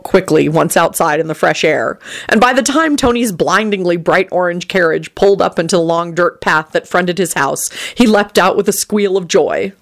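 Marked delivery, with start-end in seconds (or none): none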